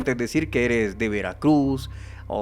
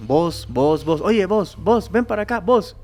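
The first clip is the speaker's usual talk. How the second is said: loud calls that climb higher and higher; calling out; 5 semitones higher, 4 dB louder, slower than usual. Retold or imitated. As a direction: call people over like street touts on the riverbank. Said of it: "todos dicen". (gritos llamando a la gente, cada vez más agudos)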